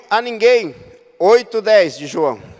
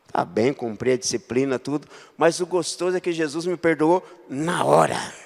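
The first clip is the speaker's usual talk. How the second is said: ordinary talk, nothing marked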